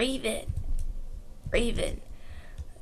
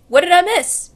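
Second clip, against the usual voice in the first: louder, bright "What did I miss?" with a lift; excited Sonic; 4 semitones higher, 8 dB louder than usual. Acted. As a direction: burst in cheerful as Sonic. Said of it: "And Sonic's like".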